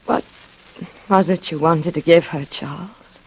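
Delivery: measured narrator voice over music; reflective narration. A narrator's reading. First-person narration over music.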